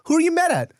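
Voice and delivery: put-on high, sing-song grown-up to a child; adult asking a kid. Parents asking the boy who he's mad at, high and sing-song. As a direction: coax it like talking to a toddler. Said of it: "they're like".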